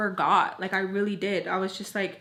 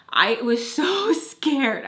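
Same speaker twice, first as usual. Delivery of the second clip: laughing; 5 semitones higher, 6 dB louder, much slower than usual. Real, not acted. Laughing while recalling fear.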